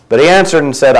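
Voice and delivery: normal talking voice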